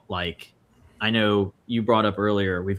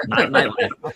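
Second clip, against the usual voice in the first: laughing self-correction; 13 semitones higher, 5 dB louder than usual. Retold or imitated. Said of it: "I was like".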